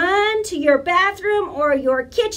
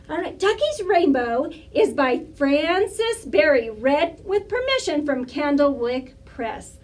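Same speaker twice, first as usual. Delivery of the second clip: ordinary delivery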